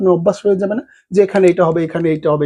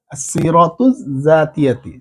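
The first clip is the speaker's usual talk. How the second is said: আসিরাতু জাতিয়াতি drawn out very slowly, syllable by syllable; very slow demo; much slower than usual. Shown as a demonstration. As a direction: stretch আসিরাতু জাতিয়াতি for learners to copy. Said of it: "CV আরবি হচ্ছে". (খুব ধীরে আসিরাতু জাতিয়াতি)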